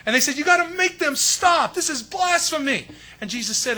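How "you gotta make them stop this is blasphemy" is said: outraged priests. Retold or imitated. Priests demanding Jesus stop them.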